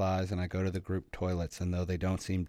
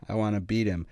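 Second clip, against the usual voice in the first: usual speaking voice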